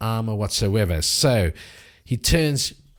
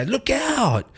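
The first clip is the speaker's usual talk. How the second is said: high, urgent call of warning; warning cry; 14 semitones higher than usual. Acted. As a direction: yell to warn a friend. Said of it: "and they go".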